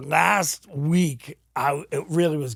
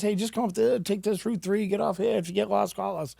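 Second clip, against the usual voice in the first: higher-pitched, even recital of directions; friendly phone helper; 7 semitones higher than usual. Retold or imitated. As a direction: voice a helpful caller in a lifted pitch. Quotes friendly man giving directions.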